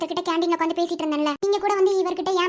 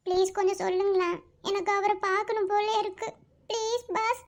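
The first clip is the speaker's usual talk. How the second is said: tearful pleading; 2 semitones higher, 4 dB quieter than usual. Acted. Girl begging the doctor to reveal the patient's whereabouts, tearful. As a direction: beg as tears take over. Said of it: "ஏன் அழுகுற?".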